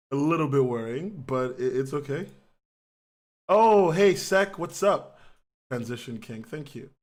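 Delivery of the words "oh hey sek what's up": higher, louder, drawn-out hello; light up as a friend walks in; excited greeting. Real, not acted. Streamer calling out hello to arriving viewer Sek, slow and loud.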